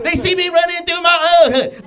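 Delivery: high, loud comic outburst; mock frantic shout. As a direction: shout it as if fleeing. Acted fleeing through own neighborhood.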